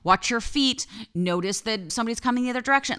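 high urgent warnings; anxious ego voice. Retold or imitated. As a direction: fire off warnings like a nervous spotter. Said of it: "ego voice that screams at you".

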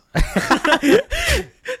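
loud squealing laugh; high burst of laughter. Real, not acted. Laughs at sister's reaction.

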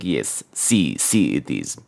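very low put-on man's voice; deep, mock-grave refusal; antique shopkeeper. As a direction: imitate a grave old movie actor, far down in pitch. Mimicking the shopkeeper.

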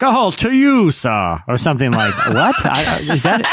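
a clumsy fake English gentleman voice; a bad British accent, laughing through it. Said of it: "That was literally the worst English accent".

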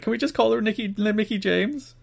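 high-pitched mock pleading; imitated co-host request. Co-host asking to just call her [name], high mock plea. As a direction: mimic a plaintive request, stretching the name. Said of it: "It's like".

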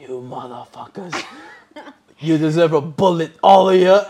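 lightly done mob boss threat, loud at the end; a hint of an Italian gangster growling. The man threatens everyone like a mob boss. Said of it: "Italian mob boss".

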